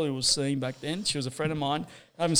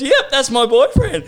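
high, loud, slow laughing boast; proud, loud and laughing; laughing, imitating the boyfriend's loud claim; laughing mock boast; 10 semitones higher, 8 dB louder, slower than usual. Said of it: "He goes, he goes".

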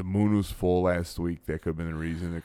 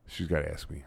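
usual speaking voice

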